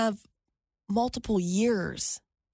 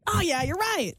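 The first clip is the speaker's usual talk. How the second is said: mock agreement; 7 semitones higher than usual. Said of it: "It's like".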